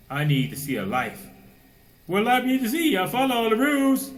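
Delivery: loud, high sermon fervor; preaching at full force. Acted. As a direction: thunder it from the pulpit.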